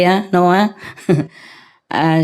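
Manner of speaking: ordinary talk, nothing marked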